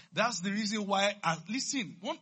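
preacher building up steam; impassioned preaching. Real, not acted. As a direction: press the point on the congregation. Preacher presses the point.

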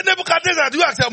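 high loud call to the king; calling question. Acted. Preacher voicing God's yearly question.

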